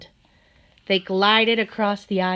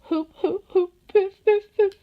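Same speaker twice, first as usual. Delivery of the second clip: high, loud sobbing gasps; 12 semitones higher, 4 dB louder than usual. Acted. Fox crying as Brownie walks away, sobbing. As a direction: cry out each word between sobs.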